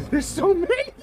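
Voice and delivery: very high, loud shriek; panicked squeal. Real, not acted. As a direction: voice shoots up into a squeal of dread. Overwhelmed, repeats it higher.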